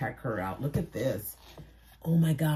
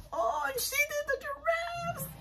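delighted squeal; 6 dB quieter than usual. Real, not acted. Delighted by giraffes.

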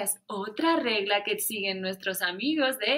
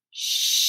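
shushing; 4 dB louder than usual. Acted. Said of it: "la niña le está diciendo".

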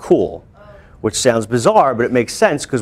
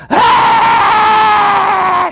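long very high loud wail; imitated toddler scream; 6 dB louder than usual. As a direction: scream like a hurt toddler carried in. Brother arrives screaming at hospital.

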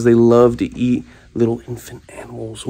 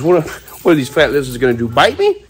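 louder, fast swaggering bravado; mock-brave taunt at fat lizards, louder and quick; mock tough talk; 2 semitones higher, 6 dB louder than usual. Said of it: "I don't know why I'm talking like this".

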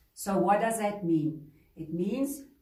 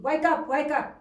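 urgent wake-up call; 6 semitones higher, 5 dB louder than usual. Acted. Boat owner rousing sleeping Jonah.

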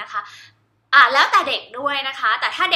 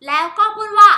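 excited lead-in; 8 semitones higher, 10 dB louder than usual. Real, not acted.

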